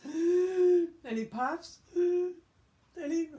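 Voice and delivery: slow, high, loud gasping effort; wolf blowing with everything; straining huff. Acted. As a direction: strain to blow.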